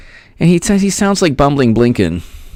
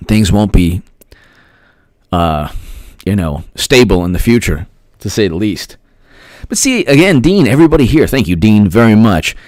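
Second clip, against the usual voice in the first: normal talking voice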